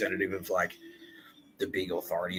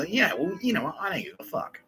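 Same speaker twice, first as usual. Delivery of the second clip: higher, brash and dismissive; cocky defiance; 11 semitones higher than usual. Retold or imitated. Han Solo defying the authorities, high and cocky. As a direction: shrug it off with swagger. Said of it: "ballsy enough to go".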